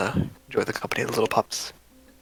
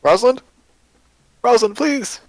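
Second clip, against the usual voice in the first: high, loud frantic calls for Rosalind; desperate searching cries; calling out desperately; 11 semitones higher, 5 dB louder than usual. Acted. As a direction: shout across the crowd searching for her. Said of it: "him going".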